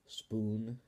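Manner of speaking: plain reading voice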